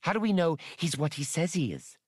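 high, suspicious challenge; demanding. A narrator's reading. Triani challenges the leader about the newcomer, demanding. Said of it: "Atwari was demanding".

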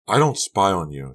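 cross, emphatic mother; angry denial. A narrator's reading. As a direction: deny it angrily. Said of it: "her voice an angry whisper".

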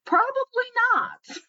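high, slow, sing-song probably not; Probably pitched high and drawn out, then not drops; drawn-out knowing no. Shown as a demonstration. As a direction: stretch out Probably up high like it's obvious. Answering own rhetorical question again.

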